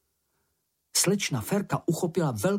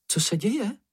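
startled squeak; 9 semitones higher than usual. A narrator's reading. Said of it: "vyjekla slečna Ferka".

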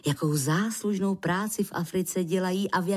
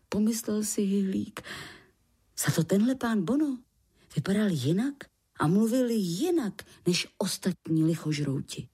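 ordinary delivery, nothing marked

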